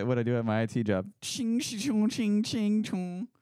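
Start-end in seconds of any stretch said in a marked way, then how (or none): 1.2-3.3 high sword-clash noises, ching and chung; sword clashing sounds. Shown as a demonstration. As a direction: make the clanging of blades with your mouth. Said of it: "me trying to explaining".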